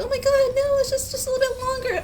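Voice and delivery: high-pitched coaxing; excited pleading. Retold or imitated. Retelling wife begging to stay longer, excited pleading. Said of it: "being excited and happy".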